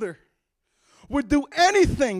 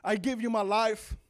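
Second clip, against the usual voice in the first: much lower, softer prayer voice; guiding listeners through a prayer of salvation; leading a prayer; 6 semitones lower, 5 dB quieter than usual. Shown as a demonstration. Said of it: "Say this prayer".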